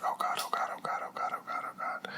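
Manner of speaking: rapid whispered pleading, acted out; panicked whisper. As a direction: whisper it over and over like a frantic prayer. Panicking as mother comes home.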